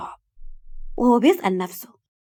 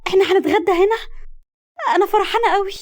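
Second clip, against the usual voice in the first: much higher, louder and thrilled; happy excitement; 10 semitones higher, 3 dB louder than usual. Acted. Dahab thrilled at lunch on the Nile boat, high and excited. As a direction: burst out with delight. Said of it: "فقالت دهب بسعادة وحماس".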